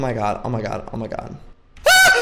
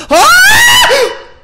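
very loud, high shriek; terrified scream; 14 dB louder than usual. Real, not acted. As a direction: scream full out at a scare. Jump scare.

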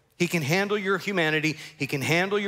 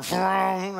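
imitated car roaring off; drawn-out mock vroom; car engine noise; 5 semitones higher than usual. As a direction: voice a revving engine pulling away. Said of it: "You can hear the car".

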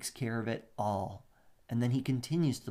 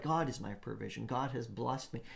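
softer, lower calm voice; peaceful believer; calm trust; 3 semitones lower, 6 dB quieter than usual. Acted. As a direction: say it with quiet confidence. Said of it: "And the opposite is like".

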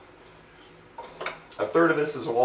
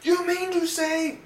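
mock angry commenter; slower than usual. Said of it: "people are, like, screaming at their video saying".

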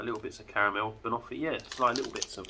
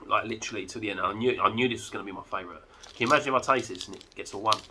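ordinary talk, nothing marked

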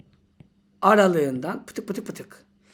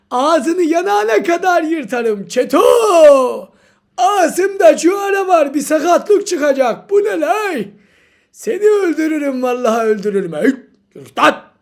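higher and louder mock fury; comic threats; 5 semitones higher, 9 dB louder than usual. Teacher threatening the clueless student, hammed-up fury. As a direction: threaten them with hammed-up rage.

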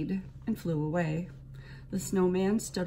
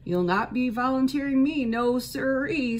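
scared rabbit; 6 semitones higher, 5 dB louder than usual. A narrator's reading. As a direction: back away nervously like a timid rabbit. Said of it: "said the scared rabbit".

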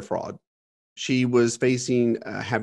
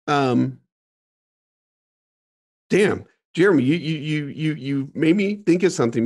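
ordinary talk

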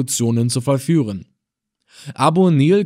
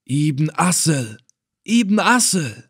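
astonished cry; 4 semitones higher, slower than usual. A narrator's reading. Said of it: "klang es laut von allen Lippen".

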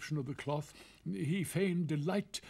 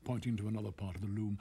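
ordinary talk

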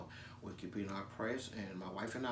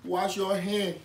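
put-on loud, fervent West African preacher accent; comic West African accented preaching; West African pastor; 6 semitones higher, 13 dB louder than usual.

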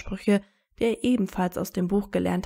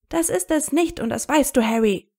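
laut und deutlich höher, wütend; lauter werdend; 6 semitones higher, 5 dB louder than usual. A narrator's reading. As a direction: im Streit die Stimme erheben. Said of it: "Hermines Stimme wurde auch lauter". (Hermine hält im Streit laut gegen Harry)

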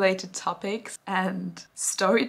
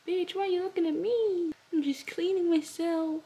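high, sassy cat voice; voicing the cat; 8 semitones higher than usual. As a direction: speak for the cat, cheeky. Voicing the cat's reply.

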